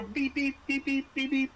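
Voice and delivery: high mock beeping of a motion tracker; playful high beep beep beep. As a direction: chirp the beeps like a scanner.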